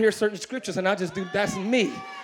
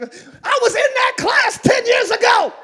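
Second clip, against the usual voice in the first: mock security guard; 13 semitones higher, 9 dB louder than usual. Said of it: "Security be like".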